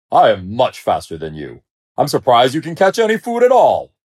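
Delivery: haughty, scornful bragging; boastful lion. A narrator's reading. Lion boasts and belittles other lions.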